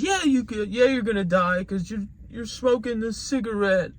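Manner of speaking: high, pleading child's cry, acted; imitated crying daughter. Daughter pleading with her smoking father, imitated hysterical crying. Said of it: "she's still crying hysterically".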